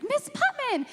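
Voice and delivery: exaggerated kindergarten call. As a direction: shout "Miss Putman!" like a thrilled small kid. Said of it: "super, um, exaggerated, you know, kindergarten".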